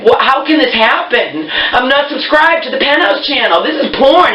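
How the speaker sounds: pitched high, incredulous protest; can't believe what the box did; exasperated disbelief. Retold or imitated. To the Comcast rep Sabrina, high exasperated disbelief.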